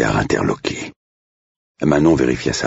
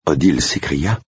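plain reading voice

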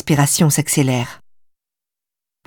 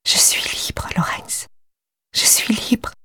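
soft trembling whisper; breathy whisper; 6 semitones higher, 5 dB quieter than usual. Acted. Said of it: "Elle murmure, souffle plus qu'elle ne prononce".

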